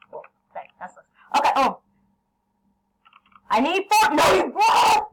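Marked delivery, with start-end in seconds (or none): 3.5-5.1 high, loud startled yell; startled shout. Real, not acted. Gamer startled by an attack, yelling high and loud. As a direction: caught off guard mid-fight. Panic in game fight.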